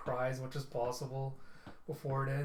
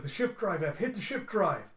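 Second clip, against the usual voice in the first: high, raised-voice emergency order; urgent yell; 5 semitones higher, 5 dB louder than usual. Retold or imitated. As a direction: urge the pilot to act now.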